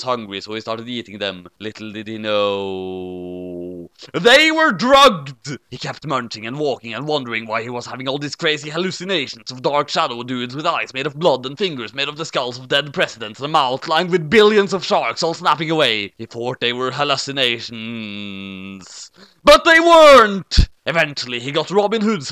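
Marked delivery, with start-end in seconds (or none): 1.6-5.6 spring the twist like a cheesy horror narrator; mock-dramatic reveal, hushed then loud. A narrator's reading. Narrator springing the drugged-candy twist, hushed then booming. 16.2-20.7 spring the twist like a cheesy horror narrator; mock-dramatic reveal, hushed then loud. A narrator's reading. Narrator springing the drugged-candy twist, hushed then booming.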